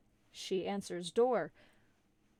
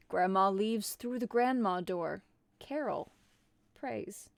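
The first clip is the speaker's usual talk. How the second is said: ordinary delivery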